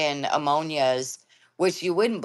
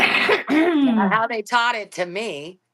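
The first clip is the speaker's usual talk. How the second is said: mock indignant; 7 semitones higher, 5 dB louder, slower than usual.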